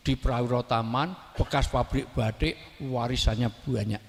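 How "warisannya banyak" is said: ordinary talk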